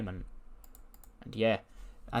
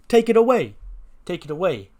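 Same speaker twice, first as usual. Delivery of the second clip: high, loud outburst easing on the repeat; shouted order; 7 semitones higher, 8 dB louder than usual. Acted. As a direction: yell the order as an outraged mob boss. Boss orders the waiter off.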